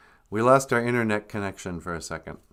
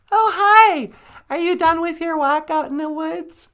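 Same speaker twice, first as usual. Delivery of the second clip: grandma voice; 5 dB louder than usual. Acted. Grandmother voice on the call.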